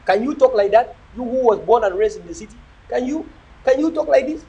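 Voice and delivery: ordinary talk, nothing marked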